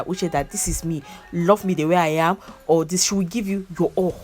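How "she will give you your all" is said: ordinary delivery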